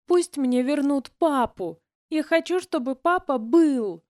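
put-on high, slow child's tearful demand; a little girl insists through tears; grieving child demanding her father back; upset child. A narrator's reading.

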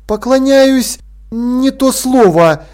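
high, drawn-out reluctant correction; awkward hedging. A narrator's reading. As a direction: search uneasily for the right word. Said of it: "испытывая некоторую неловкость".

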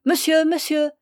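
put-on high, loud girlish call; young girl calling after a carriage, imperious; clear imperious call. A narrator's reading. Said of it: "une voix claire, impérieuse et presque enfantine appela".

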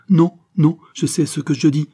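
high, loud, distressed protest; tearful outburst. A narrator's reading. Poor wife to visitors comforting her, tearful outburst. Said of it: "pleura la pauvre femme".

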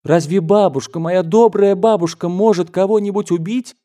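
high, pleading disbelief; distraught child's plea; near tears. A narrator's reading. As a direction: protest in distress, pitch climbing throughout. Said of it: "Я заплакал".

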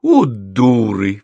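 slow, loud heartfelt exclamation; exasperated. A narrator's reading. Professor realises she was pranked, loud exasperation. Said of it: "в сердцах сказала Елена Петровна".

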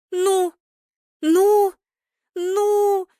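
high, drawn-out cries of suspense; tense urging. A narrator's reading. Fans watching shots hit the post, tense urging. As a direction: squeal each word in mounting hope.

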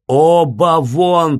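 loud, drawn-out shout; slow, heavy cry to drive them out; angry shouting. A narrator's reading. Doctor still ordering both visitors out, shouting. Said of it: "продолжал кричать Андрей Ефимыч".

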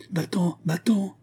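high, slow horrified cry at no great volume. A narrator's reading. Constantin stops Olga following him, crying out. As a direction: send someone away in horror. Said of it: "cria-t-il à Olga".

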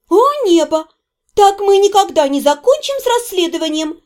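high-pitched spiteful complaint; spiteful exclamation. A narrator's reading. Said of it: "Дейла злобно скривилась".

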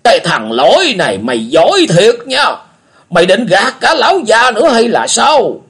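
put-on high, loud, bitter old man's jeer; spiteful old beggar. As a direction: spit it out with resentment. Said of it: "Lão Cái hằn học nói".

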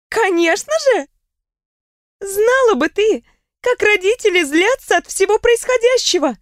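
playful, laughing friend telling gossip; lively young woman. A narrator's reading. Riona's higher voice.

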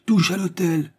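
high-pitched shouted order; calling out. A narrator's reading. Duke ordering his coachman home, calling out. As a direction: call it up to the driver. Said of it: "cria monsieur de Châteaumailly au cocher".

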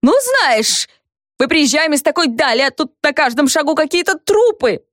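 high, squeaky indignant whine. A narrator's reading. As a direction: squeal it out, offended. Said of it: "всё ещё писклявым голосом".

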